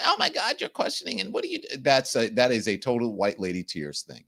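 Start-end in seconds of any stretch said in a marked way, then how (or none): 0.1-1.8 high, squeaky mock outrage, fading softer. Mimicking the offended lady, high mock outrage. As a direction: squeal it like a pearl-clutching lady. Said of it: "don't be say".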